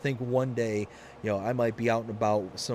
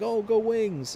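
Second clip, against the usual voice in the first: cheerful fan greeting; 10 semitones higher, 3 dB louder, slower than usual. Retold or imitated. Retold stranger greeting.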